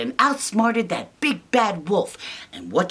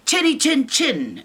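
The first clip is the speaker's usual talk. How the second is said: high pig voice; 8 semitones higher than usual. Acted. Pigs defying the wolf, high pitched. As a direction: answer back high like a pig. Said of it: "the three pigs say".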